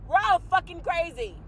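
normal talking voice

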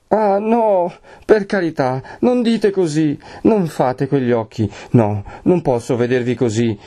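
high wail easing into a plea; tearful begging. A narrator's reading. Lucia begs with joined hands, tearful and pleading. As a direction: beg him desperately, near tears. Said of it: "piangendo, supplicando".

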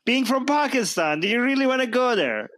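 high-pitched, insistent challenge; incredulous challenge. Real, not acted. Challenge pressed on the critic.

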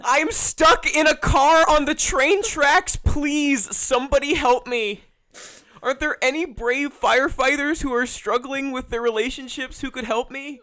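high, loud damsel cries for help; fluttering cries for help. Acted. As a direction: wail for rescue in a high voice. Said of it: "the best scene in the movie".

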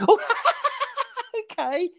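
very high giggly mock reply; imitated caller laughing. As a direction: break into a squeaky laugh on the reply. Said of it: "just laughed at everything I said".

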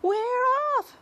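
very high, loud cheer; excited cry. A narrator's reading. The others answering the centipede, high excited cry. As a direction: echo the shout with glee. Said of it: "the others cried".

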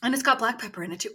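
usual speaking voice

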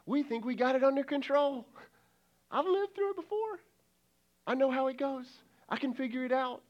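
high-pitched, airy overconfidence; mock self-assurance. People who think they need no prayer, breezily confident.

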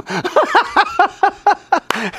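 high-pitched staccato mock laugh; acted laughing at someone; imitated mocking laughter. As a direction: put on a long, high peal of group laughter. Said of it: "They laughed at him".

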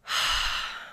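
long unvoiced sigh, letting it out; a heavy sigh of release; emotional sigh. Real, not acted. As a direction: exhale heavily before going on. Emotional pause in heartfelt speech.

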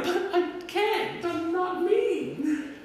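small pleading voice, playing helpless; timid little voice. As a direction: squeak it out like a shy kid who can't be scary. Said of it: "I was like".

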